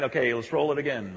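ordinary talk, nothing marked

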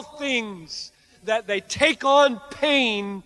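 high, loud, impassioned sermon climax; fervent preaching. Acted. Preacher urging hearers to embrace pain for God, fervent full-force preaching.

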